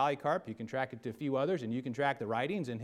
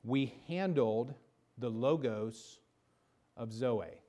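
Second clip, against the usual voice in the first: slow quote; slower than usual. Acted. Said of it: "John says".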